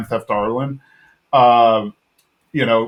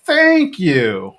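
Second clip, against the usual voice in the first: drawn-out, higher cartoon impression; Dale; 5 semitones higher than usual. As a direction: stretch it out in a put-on voice. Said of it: "And then Dale's like".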